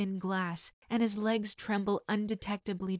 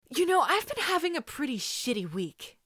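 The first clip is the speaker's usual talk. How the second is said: higher, sharp angry outburst; spitting anger; 6 semitones higher, 3 dB louder than usual. Acted. Izuku spitting his frustration at the kidnapper. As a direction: spit the words out. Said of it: "Izuku spits".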